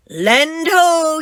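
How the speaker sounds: high, loud land ho cry; lookout yell. Acted. First mate spotting the island, high and loud. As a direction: sing out from the lookout. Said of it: "yells First Mate Callie".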